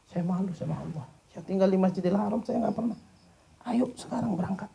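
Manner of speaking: high-pitched eager coaxing; pleading sheikh. Acted. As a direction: persuade him warmly to come. (syekh membujuk dengan suara tinggi)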